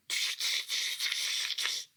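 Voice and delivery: unvoiced tsh-tsh of mocking monkeys; monkey chatter. Monkeys mocking the peddler with a breathy tsh tsh. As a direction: hiss it back like cheeky monkeys. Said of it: "the monkeys ... said".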